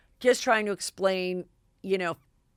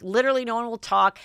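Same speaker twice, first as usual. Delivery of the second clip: ordinary talk, nothing marked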